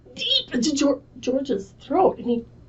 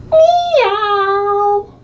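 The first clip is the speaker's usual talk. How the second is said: high, loud wailing meow; crying meow; 9 semitones higher, 7 dB louder than usual. Acted. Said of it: "and he went".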